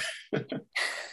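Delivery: soft, breath-only laugh; amused chuckle. Real, not acted. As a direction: chuckle quietly at your own story. Laughs at own reply.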